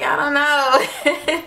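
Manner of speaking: mock-helpless and stretched out, giggling; retold helpless wail, laughed off; laughing helplessness. Retells tearful answer, laughing.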